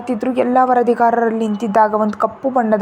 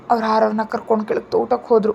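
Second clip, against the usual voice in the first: ordinary delivery, nothing marked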